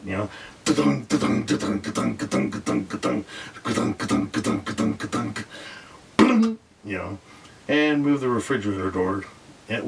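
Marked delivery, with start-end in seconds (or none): 0.6-5.5 imitating cabinets clunking.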